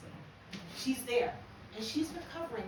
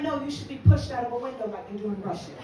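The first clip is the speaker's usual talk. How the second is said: deadpan retort; 8 dB louder than usual. Retold or imitated. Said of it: "I'm like".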